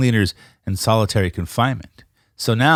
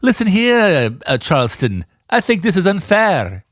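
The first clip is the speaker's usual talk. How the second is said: posh student voice; 6 semitones higher than usual. Retold or imitated. Mock posh rebel student.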